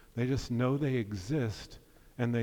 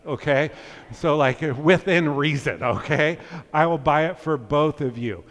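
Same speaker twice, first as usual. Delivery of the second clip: playful louder; 2 semitones higher, 7 dB louder than usual. Real, not acted. Joking about the lunch bribe.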